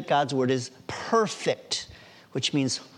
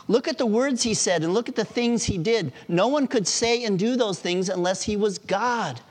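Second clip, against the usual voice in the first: higher-pitched, eager retold testimony; excited conviction; 7 semitones higher than usual. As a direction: make the case with lively conviction. Acts out convinced doctor's testimony.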